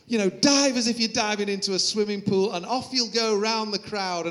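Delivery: high, eager pitch of a persuading mate; mock excited urging, lightly voiced. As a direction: talk him into it, high and keen. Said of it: "he was like".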